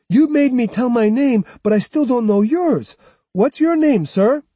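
put-on high, pushy young boy demanding; boy pressing for an answer; insistent boy voice. A narrator's reading. Jotaro demands the samurai's name.

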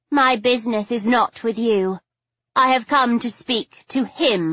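high, dismissive lady's voice; haughty lady caller. A narrator's reading. Lady dismisses the heroine for Stoker, haughty. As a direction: brush her aside with disdain.

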